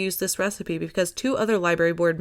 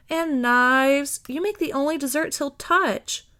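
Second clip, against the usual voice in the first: pleading; 7 semitones higher, slower than usual. A narrator's reading. Vash pleading.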